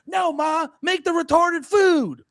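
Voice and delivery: high, drawn-out exasperated mock shout; mock bullying son. Son behind the camera with a whip, mock shouting. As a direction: yell it like a hammed-up slave-driving director. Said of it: "behind the camera with a whip going".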